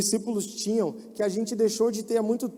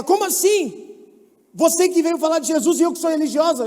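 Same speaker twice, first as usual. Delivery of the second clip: indignant surprise; 8 semitones higher, 5 dB louder than usual. Retold or imitated. Indignant girl, retold.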